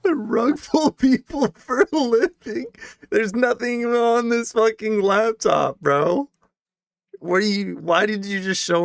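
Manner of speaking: exasperated yelling at a stream; incredulous outburst. Real, not acted. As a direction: shout it in disbelief at the screen.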